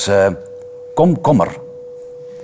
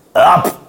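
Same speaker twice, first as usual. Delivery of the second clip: short, sudden higher "ap"; quick voiced chomp; baby's gulping snap; 5 semitones higher, 4 dB louder than usual. A narrator's reading. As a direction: imitate a child snapping up a bite. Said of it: "deed het kind".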